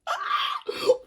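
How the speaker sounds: a very high, loud shriek of a gasp. Real, not acted. Fan pulls a photo card, shrieking gasp. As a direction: shriek at the card you pulled.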